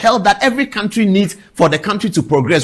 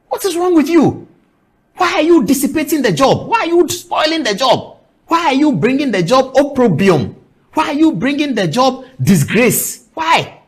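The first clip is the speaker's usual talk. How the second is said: higher pitched, indignant barrage of questions; higher, outraged questions at a colleague; exasperated outrage; 8 semitones higher than usual. Real, not acted. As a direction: scold him for shaming the job, pitch up. Host berating journalist on air.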